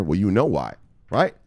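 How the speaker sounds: ordinary talk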